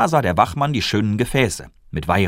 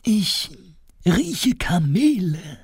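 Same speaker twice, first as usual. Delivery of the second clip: high, slow breathy whisper of wonder; a king whispering in amazement; awed whisper; 13 semitones higher, much slower than usual. Acted. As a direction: whisper it like catching a scent. Melchior sniffs out camels.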